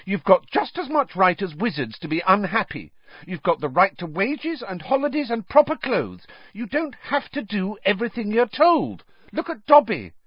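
heated, high-pitched lecture; angry. A narrator's reading. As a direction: tell them off with indignant conviction. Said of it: "said Hermione angrily".

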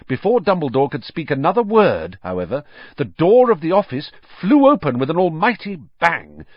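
plain reading voice

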